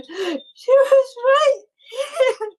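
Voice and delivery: high, slow, loud-edged sobbing words; crying little girl. Acted. Girl stumbles across searching for friend, crying. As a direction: wail helplessly like a lost child. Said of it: "crying in the distance".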